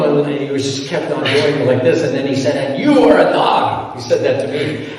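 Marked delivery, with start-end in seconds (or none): 2.6-4.0 high, slow sneering imitation; mock insult. The attacker calls the speaker a dog, mock high sneer. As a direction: play the man's sneering jab, hammed up.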